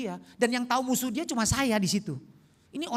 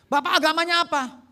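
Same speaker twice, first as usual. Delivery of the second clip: higher, louder retold questioning; urgent question; 7 semitones higher, 8 dB louder than usual. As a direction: mimic an insistent demand to know. Retold alarmed question from translator. (bertanya dengan mendesak)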